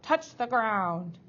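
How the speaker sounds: loud, slow, drawn-out command; stern headmaster spelling it out; snapped order. A narrator's reading. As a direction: stretch the order out loudly. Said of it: "Mister Kumba snapped out".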